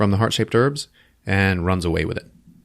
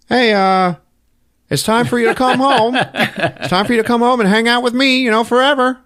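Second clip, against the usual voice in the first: much higher, louder, airy otherworldly call; ghostly father; 4 dB louder than usual. Retold or imitated. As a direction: beckon your son into the afterlife. T'Challa's dead father calls him home.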